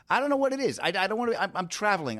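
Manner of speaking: high-pitched cranky rant; cranky complaining. Acted. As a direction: gripe like a put-upon traveler. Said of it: "I'm a cranky little man".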